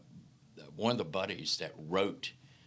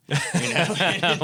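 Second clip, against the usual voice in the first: much higher and louder, bursting out laughing; real laugh; 10 semitones higher, 13 dB louder than usual. Real, not acted. Laughing hard with the host at the joke. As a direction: crack up at your own joke.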